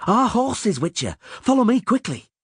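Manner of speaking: high, urgent summons; Milva's yell. A narrator's reading. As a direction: call them to follow through the chaos. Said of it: "Milva yelled".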